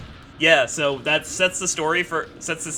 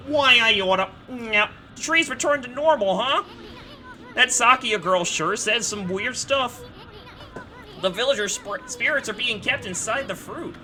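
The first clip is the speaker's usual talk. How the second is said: slightly higher casual sprite; bug voice attempt; 10 semitones higher, slower than usual. Shown as a demonstration. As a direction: try out a tiny bug's voice. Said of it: "It's gonna go".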